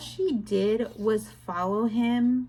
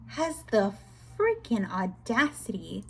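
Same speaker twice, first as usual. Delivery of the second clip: normal talking voice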